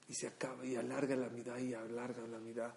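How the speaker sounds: very soft, low, near-whispered narration; hushed storytelling. Real, not acted. Storyteller describes a child's long tearful prayer, hushed. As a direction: drop to a hush, low and intimate.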